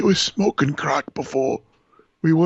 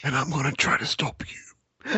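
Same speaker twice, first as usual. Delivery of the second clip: whisper; 3 semitones lower than usual. Retold or imitated. Imitating the whispered voicemail.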